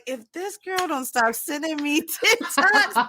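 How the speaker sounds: mock exasperated friend; imitating annoyed friend. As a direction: play the fed-up friend, lightly. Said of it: "now you're gonna be like".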